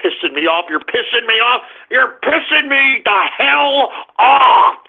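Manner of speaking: building anger. Real, not acted. Radio host fed up with prank callers, anger building. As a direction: start muted, build with each repeat.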